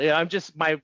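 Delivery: ordinary talk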